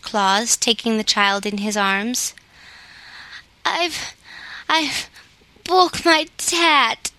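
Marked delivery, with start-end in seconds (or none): 3.6-7.1 put-on high, slow, halting child's sob; sobbing little girl. A narrator's reading. Little Mayre sobbing to Claus over her broken toy, high and halting. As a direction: stammer it out through tears like a small girl. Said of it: "sobbed Mayre".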